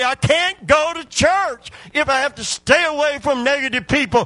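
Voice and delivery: high, loud preaching to the congregation; full-force preaching. Acted. Preacher rousing the church to praise, loud and high. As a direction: build the crowd up and drive it home.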